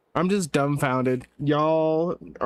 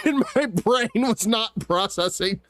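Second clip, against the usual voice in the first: words pushed out through laughter, higher; laughing while talking; 5 semitones higher than usual. Real, not acted. Admitting to chat his brain failed, through laughter. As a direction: talk while still giggling.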